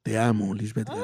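low, smooth radio seducer; sultry narrator. Shown as a demonstration. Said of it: "Hágame la voz seductora".

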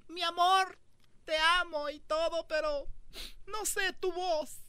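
high sweet woman voice, slowing at end. Retold or imitated. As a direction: play the girlfriend, high and sweet.

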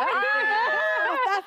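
high, slow, stretched-out hello; excited greeting. Retold or imitated. Actor greets his fan in a video, drawn out and high.

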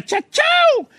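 very high-pitched catchphrase yell; Can's shout. Retold or imitated. Showing how Can shouts it, high yell. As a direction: whoop it up high. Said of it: "o sea, el Can grita".

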